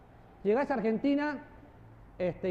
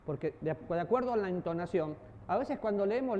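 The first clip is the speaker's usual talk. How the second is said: ordinary talk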